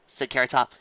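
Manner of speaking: ordinary delivery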